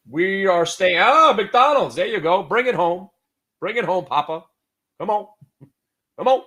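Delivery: loud, high urging; excited cheering. Real, not acted. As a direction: cheer the stock home. Cheering on a trade live.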